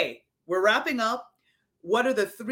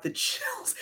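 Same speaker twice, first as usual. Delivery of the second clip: whispered, much higher; awed whisper; 13 semitones higher, 8 dB quieter than usual. Real, not acted. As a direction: breathe it out in awe. Listener moved by the story.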